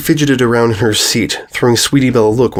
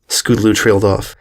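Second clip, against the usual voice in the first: ordinary delivery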